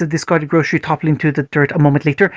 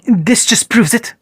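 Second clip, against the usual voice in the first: contempt; 6 semitones higher, slower than usual. Acted. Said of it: "said with contempt".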